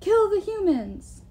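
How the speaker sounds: high, drawn-out battle cheer; giants' cheer. A narrator's reading. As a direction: shout it slowly like a roaring crowd. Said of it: "let loose a deafening cheer".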